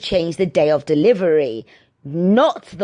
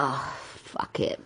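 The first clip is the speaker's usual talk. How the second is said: hushed, much softer and slow resigned whisper; whispered thought; 5 semitones lower, 14 dB quieter than usual. Retold or imitated. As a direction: breathe it out like giving up. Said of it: "I thought".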